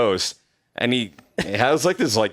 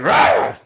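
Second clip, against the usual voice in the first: a short, loud, high animal roar; a sudden pained beast's growl; T-Rex growl; 14 semitones higher, 6 dB louder than usual. Retold or imitated. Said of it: "literally growls like a T-Rex".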